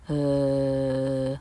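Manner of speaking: "呃" as a long, lax, low schwa; weak schwa. Shown as a demonstration. Teacher showing the unstressed vowel, drawn-out schwa. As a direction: hold a relaxed, level "呃". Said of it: "要判读为第十一号母音".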